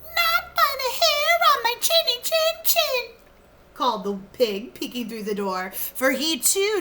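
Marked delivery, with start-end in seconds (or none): 0.0-3.1 high pig voice. Acted. Pig answers wolf.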